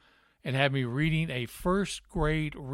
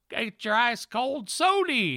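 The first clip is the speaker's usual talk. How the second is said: high, slow barked vendor call of sody; stadium vendor call; 9 semitones higher, 4 dB louder, slower than usual. Retold or imitated. Vendor selling drinks at a Cardinals game, hawking call. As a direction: bark it out like a ballpark hawker. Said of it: "the guys barking in the background".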